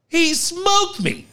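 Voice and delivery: high, drawn-out exclamation; animated retelling. Retold or imitated. Telling how he got hit, animated retelling. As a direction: stretch it out high, mock-dramatic.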